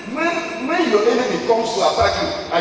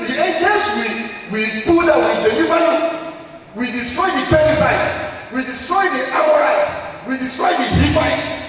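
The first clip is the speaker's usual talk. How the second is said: higher, louder chant of victory; triumphant declaration; 7 semitones higher, 4 dB louder than usual. Acted. Angels declare victory over the enemies, loud and high. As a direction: proclaim it like conquering angels.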